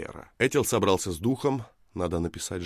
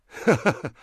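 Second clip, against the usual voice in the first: high, loud acted laugh; scoffing ha-ha-ha; mocking laugh; 10 semitones higher, 7 dB louder than usual. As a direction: laugh loudly at a silly idea. Woman laughs mockingly at him.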